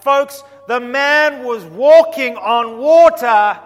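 slow, loud, ringing proclamation; preaching climax. Real, not acted. Preacher to congregation, slow loud proclamation. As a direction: hammer it home to the congregation.

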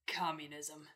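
low whispered word. Retold or imitated. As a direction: breathe it out as a secret. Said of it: "the first thing he said was".

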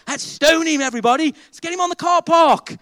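high, loud hammed-up call to stone him; mock mob call. Preacher jokingly urging the crowd to stone Matt, mock rallying call. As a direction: play the eager mob, tongue in cheek.

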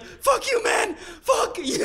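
high mock yell of an overwhelmed fan; imitated yelling. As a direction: shout it up high, overcome with joy. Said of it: "He was literally like".

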